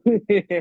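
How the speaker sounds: short loud burst of laughter. Real, not acted. Laughs at his own remark.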